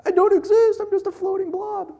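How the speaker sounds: comic very high squeaky wail; cartoonish panic in a tiny falsetto; God. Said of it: "God's gonna be like".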